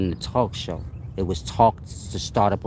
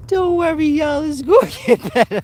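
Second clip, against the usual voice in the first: laughing; 5 dB louder than usual. Real, not acted. Reassuring viewers while laughing.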